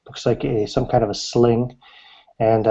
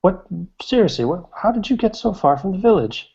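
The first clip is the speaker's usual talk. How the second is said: put-on much higher, cheery old hermit voice; old woodcutter voice; 9 semitones higher than usual. Game master voicing the old woodcutter greeting a stranger, high cheery old voice. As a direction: greet the stranger like a jolly old mountain man. Old man asks again.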